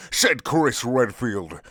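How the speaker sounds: normal talking voice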